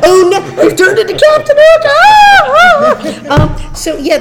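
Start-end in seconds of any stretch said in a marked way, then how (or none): 0.0-1.8 high loud mock horror. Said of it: "And it's like". 1.9-3.7 high loud laughing ahs; laughing shriek. Retold or imitated. Mock shriek while laughing.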